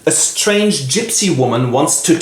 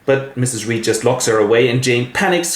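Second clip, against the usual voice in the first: normal talking voice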